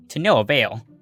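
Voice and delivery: plain reading voice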